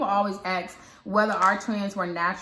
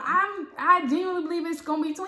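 insistent retort; 9 semitones higher than usual. Retold or imitated. Wife insisting to husband it's twins, high and emphatic. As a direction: push back with excited certainty. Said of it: "I'm like".

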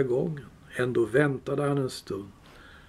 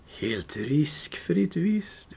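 slow, hushed creature whisper to himself; Gollum whispering; 4 dB quieter, much slower than usual. Acted. Gollum muttering to himself, slow hushed whisper. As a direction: breathe it out slowly, a sly creature to himself. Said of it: "viskade han för sig själv".

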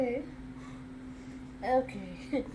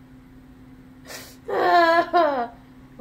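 hysterical laugh; 5 dB louder than usual. Real, not acted. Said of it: "She's killing herself".

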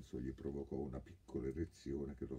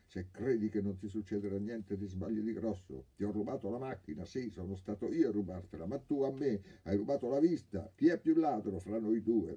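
higher, louder threat building to a shout; threatening; 6 semitones higher, 7 dB louder than usual. A narrator's reading. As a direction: spit the accusation back, getting louder. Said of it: "Ma il ladro minacciò".

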